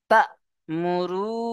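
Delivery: তা'মুরুনা intoned as in recitation, with রু stretched one alif; Quranic recitation. Shown as a demonstration. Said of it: "চলেন, ওইভাবে".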